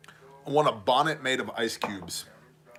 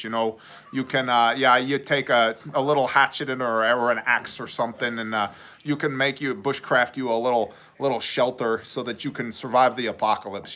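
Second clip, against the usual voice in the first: chatty mock-Canadian survivalist pitch; Canadian prepper. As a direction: ramble on in a put-on Canadian accent. Plays a Canadian prepper pitching gear.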